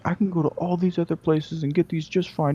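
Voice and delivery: high, indignant complaint; angry customer. Retold or imitated. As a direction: insist it's fine everywhere else. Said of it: "he's going like".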